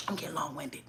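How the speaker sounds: whispered under the breath; whispered aside. Real, not acted. Vlogger whispering an aside to viewers about rambling.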